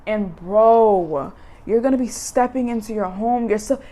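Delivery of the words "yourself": normal talking voice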